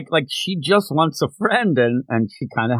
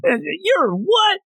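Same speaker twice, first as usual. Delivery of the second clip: mock confusion. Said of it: "Power yelling confused".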